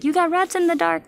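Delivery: mock scared friend. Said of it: "They'll be like".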